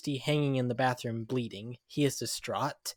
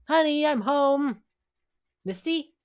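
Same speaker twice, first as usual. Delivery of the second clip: cheerful husband calling; 13 semitones higher, 4 dB louder than usual. Acted. Comic calling to his wife as he comes home, high cheerful call. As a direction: call out happily for her, much higher.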